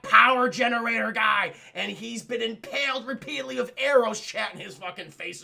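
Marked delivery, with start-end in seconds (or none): 0.0-1.5 loud booming reveal; loud, hyped horror-recap punch; dramatic reveal. Retold or imitated. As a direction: shout out the shock reveal.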